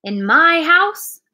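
high, loud, slow disbelief; indignant outcry. A narrator's reading. Silver hears of pirates in his tavern, indignant. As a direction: draw it out, scandalised.

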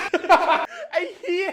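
high laugh and laughing curse. Real, not acted. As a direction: burst out laughing. Laughing at reply.

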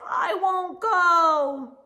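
slow, high, loud pouty refusal; a stubborn little boy digging in his heels; a reluctant child. A narrator's reading. A kid on the first day of school, refusing to go, pouting. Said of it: "Ian Lowe says".

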